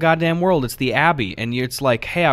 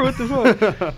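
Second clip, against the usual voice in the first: surprised; 10 semitones higher, slower than usual. Pretend. Mock shock, laughing.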